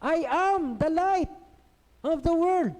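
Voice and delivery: high, loud, slow declaration; Jesus crying out to the people, high and loud; proclaiming shout. Acted. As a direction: ring it out to the whole crowd. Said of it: "biglang sisigaw".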